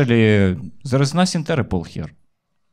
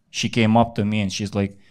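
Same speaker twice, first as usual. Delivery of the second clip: ordinary delivery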